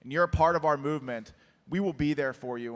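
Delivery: ordinary talk, nothing marked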